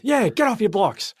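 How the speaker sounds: loud, high, drawn-out official's shout; imitated yell. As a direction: yell back the order, slow and forceful. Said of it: "You don't need to yell at me".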